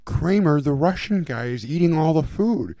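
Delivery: high, loud, alarmed Elaine impression; imitating Elaine. Retelling Elaine's complaint to Kramer, high loud mock alarm. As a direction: squeal it up at her, tattling on the guest. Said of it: "she's like".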